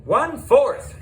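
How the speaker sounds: high, loud proud shout; shouted answer. A narrator's reading. Keen pupil shouting the answer in class, high and loud. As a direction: call it out fast and sure. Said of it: "shouted Alessia".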